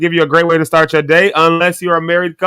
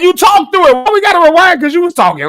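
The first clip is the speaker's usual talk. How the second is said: high-pitched, louder mock protest; retold exasperated complaint; 14 semitones higher, 4 dB louder than usual. Speaker retells arguing over rewinding a movie, exasperated. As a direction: complain in a raised, incredulous voice.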